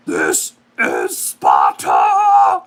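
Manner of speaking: deep, very slow bellowing war cry; deep drawn-out Spartan battle roar; Sparta shout. Retold or imitated. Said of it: "who just had to hear that recreation".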